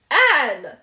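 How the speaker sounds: sharp loud call of the name; shocked exclamation. Acted. Guardian cutting off the furious girl, shocked loud exclamation. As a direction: stop her short in alarm. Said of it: "exclaimed Marilla in consternation".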